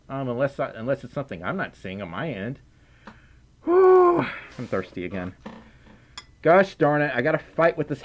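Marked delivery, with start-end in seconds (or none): 3.6-4.2 high, loud airy whew; a loud exhaled whew. Real, not acted. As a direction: let out a big high "whew". Sigh of relief after talking.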